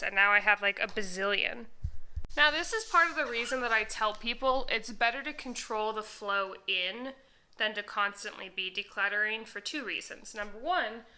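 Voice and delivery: ordinary delivery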